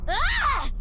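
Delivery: sharp high yelp of shock; sudden gasp of alarm; startled cry. Acted. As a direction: cry out as the world floods in. Dive into Somnium, overwhelmed.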